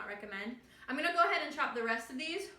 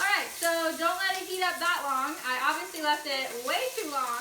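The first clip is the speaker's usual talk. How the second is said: flustered; 5 semitones higher, 8 dB louder than usual. Real, not acted. After the oil spattered.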